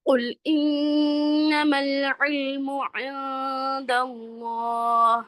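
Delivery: slow, drawn-out melodic tajweed recitation; a teacher demonstrating the verse for students; Quranic recitation. Shown as a demonstration. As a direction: recite it slowly with full tajweed. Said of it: "ٹھیک ہے؟".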